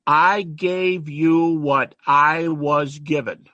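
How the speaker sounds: slow and deliberate, each word weighed; slow solemn quote. Shown as a demonstration. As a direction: let the words land one by one. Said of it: "watch his words".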